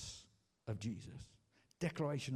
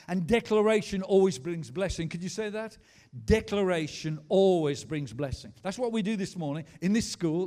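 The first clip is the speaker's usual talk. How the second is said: loud, higher-pitched declaration from the pulpit; full-force preaching; 5 semitones higher, 9 dB louder than usual. Real, not acted. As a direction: build it phrase by phrase, rousing the congregation.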